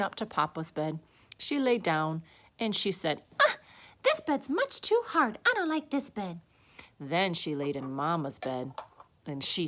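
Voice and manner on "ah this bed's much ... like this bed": put-on high little-girl complaint; fussy child voice, pitched way up; displeased Goldilocks. A narrator's reading. Goldilocks rejecting a chair or bed, high displeased voice.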